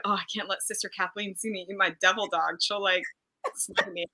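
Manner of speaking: ordinary talk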